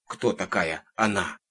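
plain reading voice

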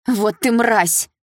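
slow, loud, high angry cry; furious outburst. A narrator's reading. Dilara bursting out at Mel, furious and loud. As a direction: spit out each word, losing her temper. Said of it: "не выдержала Дилара".

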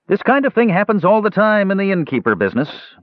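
very high-pitched innkeeper voice; Heyu. A narrator's reading. Innkeeper confides to the dwarf, high character voice. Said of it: "Heyu confided to the dwarf".

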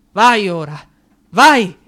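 delirious cries rising to a shout; frantic shouting. Acted. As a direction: drive him out the door at the top of your voice. Raving, urging friend away.

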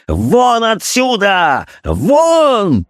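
high, drawn-out furious yell; raging shout. A narrator's reading. Doctor drives his wife out, long raging shout. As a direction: order her out in a long shout of rage. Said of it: "неудержимой ярости".

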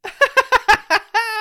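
a high laugh that shrieks out; high shrieking laugh. Real, not acted. Laughs at neck-tied scarf image.

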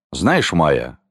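low, grinding delivery; grating voice. A narrator's reading. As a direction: grate it out low, holding back. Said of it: "проскрежетал Сильвестр". (скрежещущим низким голосом)

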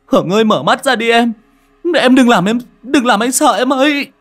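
high, loud frantic grief; young man crying over his unconscious lover; anguished cry. Acted. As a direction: call out to them in despair. Grief over fallen family.

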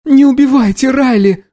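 put-on high-pitched desperate girl; pleading. A narrator's reading. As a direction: beg in a high cry. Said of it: "взмолилась Анна".

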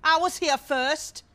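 loud, high-pitched affronted outburst; huffy, high objection; indignant protest. Acted. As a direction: butt in loudly to claim your turn. Indignant at being pushed aside.

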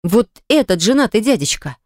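ordinary delivery

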